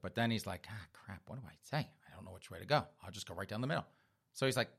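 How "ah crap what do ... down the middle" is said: low, soft aside; muttered dread. Acted. Boyfriend stuck on his girlfriend's question, muttered dread. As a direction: think aloud under your breath, cornered. Man thinking to himself, cornered.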